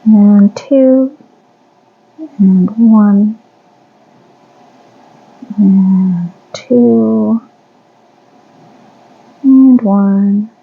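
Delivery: counting stitches. Real, not acted. Counting crochet stitches aloud.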